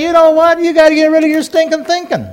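high, emphatic mock retort; playful high-pitched admonishing; imagined Jesus retort. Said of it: "I think Jesus would say".

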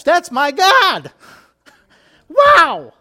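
loud joyful exclamation, pitch jumping high; excited outburst. Real, not acted. Preacher to congregation, exulting loudly over his God. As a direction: burst out with delight.

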